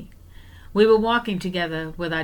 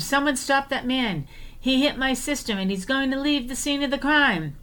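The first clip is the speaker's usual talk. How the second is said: retold scream; 6 semitones higher than usual. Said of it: "So I screamed".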